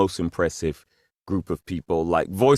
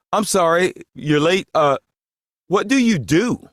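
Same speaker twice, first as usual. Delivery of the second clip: mock innocent question; 8 semitones higher than usual. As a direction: ask the star who he is, pitched well up. Feigns not knowing the famous star.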